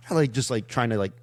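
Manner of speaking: ordinary delivery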